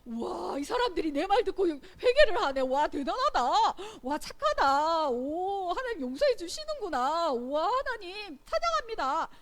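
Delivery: loud, high, gushing praise; mock delighted reaction. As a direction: marvel out loud with hammed-up delight. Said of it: "요나의 반응은 어땠을까?". (크고 높은 목소리로 과장되게 감탄하는 연기)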